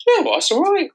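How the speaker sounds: high, cheerful mock relief; high chirpy voice. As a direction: squeak it out, suddenly cheery. Voicing depressives around the world.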